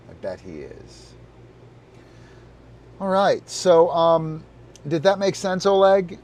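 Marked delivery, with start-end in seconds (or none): 3.0-6.2 higher, louder bright question; upbeat check-in. Real, not acted. Streamer perking up to ask viewer Oleg. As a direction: perk up and check in.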